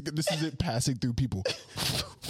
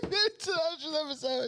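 high mock voice. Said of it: "He said".

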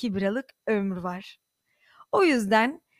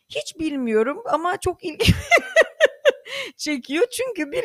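laughing; 8 semitones higher, 4 dB louder than usual. Real, not acted. Güle güle anlatıyor.

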